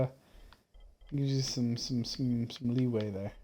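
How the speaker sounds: ordinary talk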